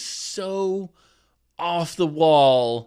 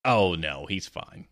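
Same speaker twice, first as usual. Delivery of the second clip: softer, lower, half-whispered brush-off; dismissive aside; 7 semitones lower, 5 dB quieter than usual. Retold or imitated. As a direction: wave off the worry quietly. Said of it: "And I'm like".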